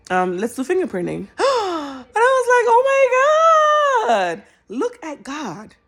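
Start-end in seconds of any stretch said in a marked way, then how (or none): 1.3-2.1 a sharp surprised intake of breath; sudden high gasp; high gasp of amazement. Real, not acted. Amazed as fingerprinting begins. 2.1-5.7 much higher and louder in amazement; high, loud, amazed exclamation. Real, not acted. Thanks God in amazement.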